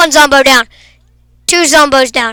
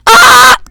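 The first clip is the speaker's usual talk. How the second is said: high, loud mock shriek; claiming the right to scream, shriek high; playful scream; 10 semitones higher, 7 dB louder than usual. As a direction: let out a silly scream. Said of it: "I'm the only one allowed to scream".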